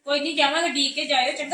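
calling out. Acted. Aunt calls loudly to the niece about the trip. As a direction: call out cheerfully to the child.

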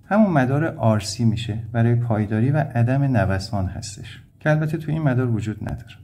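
ordinary talk, nothing marked

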